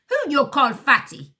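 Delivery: put-on loud, high, slow accented outrage; first henchman offended, loud accented retort; accented indignation. A narrator's reading. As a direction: fire back offended, thick accent. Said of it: "says the first man".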